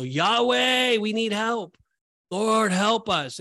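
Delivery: slow pleading outcry of Lord, help us; desperate cry. Retold or imitated. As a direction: call out to God in desperation. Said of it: "calling it out in desperation".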